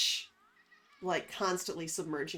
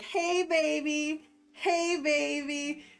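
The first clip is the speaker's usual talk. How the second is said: flirty catcall; 7 semitones higher, 3 dB louder, much slower than usual. Pretend. Calling to jiggly arm flesh.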